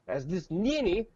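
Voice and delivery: scornful. Real, not acted. Mocking bureaucrat with filter voice.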